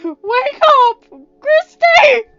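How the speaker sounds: high, loud scream of grief, voice cracking with tears; anguished crying shout. Acted. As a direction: scream her awake through sobs. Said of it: "Ryan shouted".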